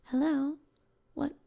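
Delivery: a lightly voiced soft-spoken child; soft childlike voice. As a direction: sound like a small child at the door. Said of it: "soft-spoken, almost, like, childlike voice".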